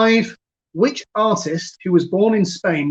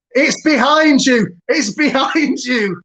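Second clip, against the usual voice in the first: panto call; 8 semitones higher, 5 dB louder, slower than usual. Pretend. Pantomime heckle about fire.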